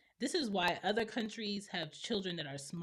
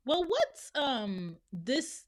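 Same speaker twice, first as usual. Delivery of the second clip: high, loud, hesitant student question; mock questioner; 5 semitones higher, 4 dB louder, much slower than usual. Student holding up the class with a question, mock hesitant. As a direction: stall into the question, starting high.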